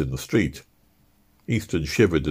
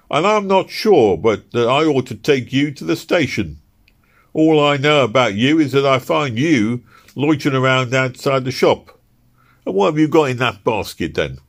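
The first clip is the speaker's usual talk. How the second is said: loud, high gruff constable voice; a stern London bobby challenging him; london policeman; 7 semitones higher, 5 dB louder than usual. Acted. Policeman voice in story.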